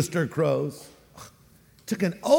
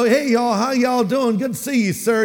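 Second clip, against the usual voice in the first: higher-pitched, friendly Southern greeting; Southern accent; 5 semitones higher than usual. Acted. Southerner greeting someone, imitated Southern accent. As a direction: put on a Southern y'all accent. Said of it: "Are you from the South?".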